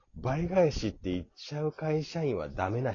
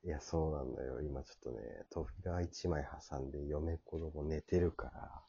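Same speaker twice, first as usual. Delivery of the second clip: hushed, softer voice; quiet at night; 7 semitones lower, 8 dB quieter than usual. Real, not acted. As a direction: keep it down, family's asleep next door.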